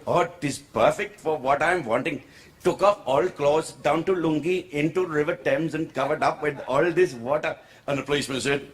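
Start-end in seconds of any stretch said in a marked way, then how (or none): 0.1-7.6 joke-teller's put-on Indian English accent. Uncle in London spots the river sign, Indian accent. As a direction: tell it in a broad Indian accent. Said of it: "I won't do the accent".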